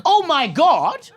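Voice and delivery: high, slow, drawn-out taunt; gleeful prankster kids. Retold or imitated. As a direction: imitate kids crowing over a prank. Kids springing prank, acted out.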